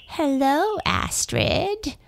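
a wicked hag gloating from her window; cackling witch. A narrator's reading. Long Nose greets Astrid from above, cackling high. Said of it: "she cackled".

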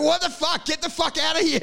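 high-pitched angry shout, played for comedy; mock outraged yell. Gang confronting rivals who rock up, mock outraged yell. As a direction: yell at intruders in mock fury.

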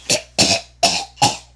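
loud hammed-up coughs; coughing fit. Singer with pneumonia interrupted, mock coughing fit. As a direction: cough hard mid-song.

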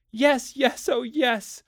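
very high, eager run of agreement; frantic. A narrator's reading. Wife begs to do her own housework, frantic and high. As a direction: pile up the yeses in desperate eagerness. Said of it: "A frantic, watery-eyed nodding".

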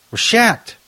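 high mock Obama voice, pitched well up; Obama impression. Host showing off his Obama impression to the co-host. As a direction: echo the president, hamming it high. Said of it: "It's my Obama impression".

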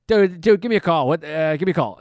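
loud, high, urgent caller impression; hammed-up fiery phone demand; fiery, hot-tempered. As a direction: bark it like a hot-tempered friend demanding a callback. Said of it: "he's got a temper".

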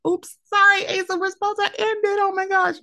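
high-pitched mock apology; sheepish apology. Apologizing to auntie Asa for rambling on, high retold squeak.

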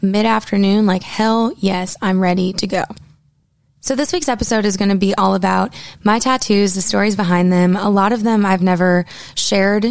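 normal talking voice

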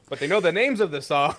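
usual speaking voice

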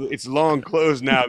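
ordinary talk

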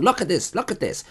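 hammed-up furious customer; imitating the angry woman.

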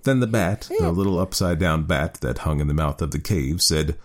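usual speaking voice

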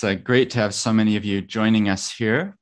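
usual speaking voice

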